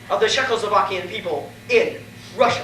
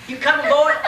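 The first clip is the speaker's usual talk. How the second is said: loud hillbilly call to a son; 6 semitones higher, 5 dB louder than usual. Acted. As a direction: shout across to the boy. Father calls to son.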